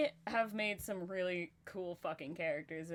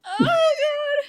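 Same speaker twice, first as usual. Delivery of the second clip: high squealing laughter; laughing hard; 11 semitones higher, 17 dB louder than usual. Real, not acted. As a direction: breaks down laughing. Laughing fit after the bit.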